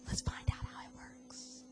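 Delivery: hushed aside. Acted. Dramatic aside in sermon.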